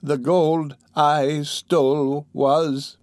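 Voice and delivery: put-on very slow, halting old woman; dying woman fading. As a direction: drag out each word, unable to finish. Dying woman's last words.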